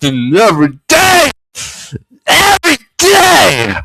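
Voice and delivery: slow, high, crying shouts; theatrical sobbing lament; hammed-up wailing. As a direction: wail each every day like a tragic aria.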